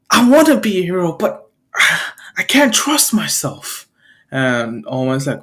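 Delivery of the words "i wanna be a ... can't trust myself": higher-pitched, conflicted pleading; distressed confession. Acted. Boy who wants to be a hero, distressed. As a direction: voice lifted in helpless self-doubt.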